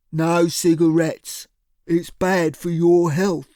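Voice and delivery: slow, heavy thug voice. A narrator's reading. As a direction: loom over her, slow and heavy. Cyril's thug voice.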